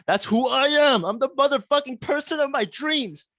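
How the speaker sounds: high, exultant brag; triumphant boast. Real, not acted. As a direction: crow it like you just won.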